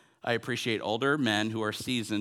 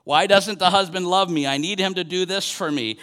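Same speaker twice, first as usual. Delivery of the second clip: raised, pleading complaint, louder and higher; retold screaming; 5 semitones higher, 6 dB louder than usual. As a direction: mock-scream a spouse's grievance. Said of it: "the wife is screaming in her language".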